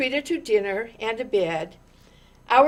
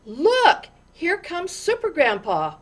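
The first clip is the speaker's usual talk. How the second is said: higher, eager call pointing him out; excited cry; 5 semitones higher, 4 dB louder than usual. A narrator's reading. Little girl in the crowd calling out, excited. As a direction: announce him with childlike glee. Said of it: "cried a little girl".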